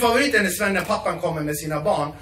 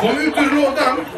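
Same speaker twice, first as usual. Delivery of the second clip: higher and louder, laughing through it; louder urging; 7 semitones higher, 6 dB louder than usual. Retold or imitated. Dad urging his son to come out, louder and laughing. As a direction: push the line harder, half laughing.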